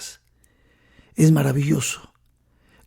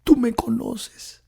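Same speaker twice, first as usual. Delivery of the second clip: higher, softer, near-whispered confession; tearful plea; 6 semitones higher, 3 dB quieter than usual. Acted. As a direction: plead through tears, a touch of weeping. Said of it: "Con lágrimas en los ojos le dijo".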